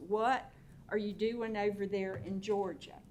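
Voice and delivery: ordinary delivery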